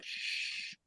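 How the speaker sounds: unvoiced shush; a breathy hushing shh; shushing whisper. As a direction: hush them with a whispered shh. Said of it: "he's like".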